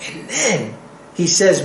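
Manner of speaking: ordinary delivery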